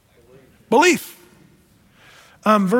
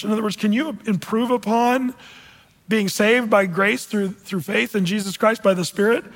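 usual speaking voice

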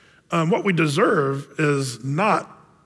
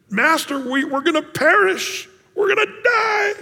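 high, frantic cry of fear; mock terrified fishermen; panicked disciples; 11 semitones higher than usual. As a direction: wail it like men sure they'll drown.

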